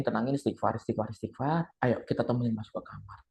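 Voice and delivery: soft, low soothing; calm her down gently; softly coaxing a scared friend. Retold or imitated.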